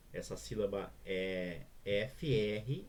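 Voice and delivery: ordinary delivery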